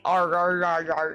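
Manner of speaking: "Arr" repeated with hard, exaggerated R's; aggressive American R's. Shown as a demonstration. American asking chat how the accent sounds, "Arr" with aggressive R's. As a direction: lean hard on the R in every "Arr". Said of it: "pronounce our R's, like, really aggressively".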